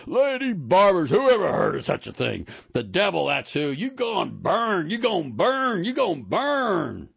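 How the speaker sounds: high, loud screaming rant; screaming preacher. A narrator's reading. Preacher thrown out screaming at the barber shop, shrieking. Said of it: "as he struggled and screamed".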